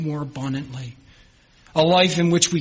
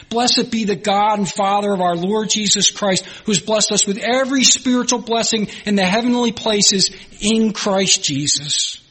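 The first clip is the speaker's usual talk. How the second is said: rising preaching; 6 semitones higher than usual. Acted. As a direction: lift it up in praise.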